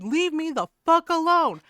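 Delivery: loud, high acted outburst; imitated yelling. Woman telling prankster off, imitated yell. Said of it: "She yelled, she's like".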